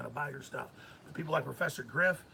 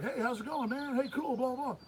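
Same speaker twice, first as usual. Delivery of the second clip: breezy high chatty "hey man"; tossed-off friendly hello, pitched up; casual celebrity greeting; 8 semitones higher than usual. Retold or imitated. Imitating the guy greeting him offhand. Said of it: "He's like".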